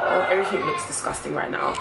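ordinary talk, nothing marked

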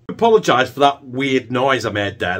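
normal talking voice

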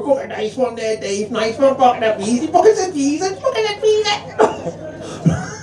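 mock gang of lads egging a mate on; cheering Scouse mates. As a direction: imitate rowdy Scouse mates, building in excitement. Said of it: "all the Scouts mates go".

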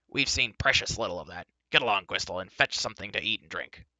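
a touch of irritation, punched words; snappish. A narrator's reading. Dolly ordering Gwistle to fetch food, snappish. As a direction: order him about with mild impatience. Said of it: "snapped Dolly".

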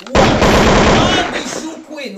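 high, loud, slowed-down hype shout. Acted. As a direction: stretch it out loud to cheer the birthday queens. Shouting to June birthdays.

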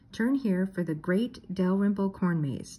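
slow, careful sign reading. Acted. Daphne reads the homemade roadside sign aloud, slowly. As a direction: spell out each word of the roadside sign slowly. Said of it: "Daphne read".

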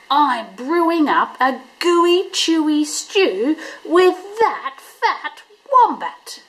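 a scheming dog savouring each word; drawn-out sly Dingo with a grin. A narrator's reading. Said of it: "replied Dingo with a toothy grin".

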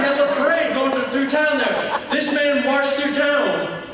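high, exclaimed sermon storytelling; full-force preaching. A narrator's reading. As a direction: cry out the climax of the tale to a congregation.

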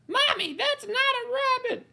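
put-on very high little kid asking mommy; small child voice.

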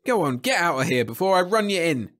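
loud, high officer's yell. A narrator's reading. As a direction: chase him off with a threat. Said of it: "yelled the officer".

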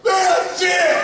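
very high explosive mock shout; hammed-up outburst at the top of the voice; Chris Farley. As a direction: blow up like a comic storming over. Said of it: "he storms over and goes".

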